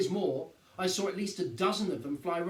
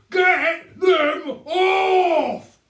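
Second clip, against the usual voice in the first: shrill frantic scream, much higher and louder, very slow; 14 semitones higher, 17 dB louder, much slower than usual. A narrator's reading. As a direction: scream it in panic at the birds. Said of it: "screamed Mister Hazel".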